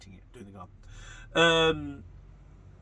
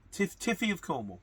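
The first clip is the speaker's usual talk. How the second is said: normal talking voice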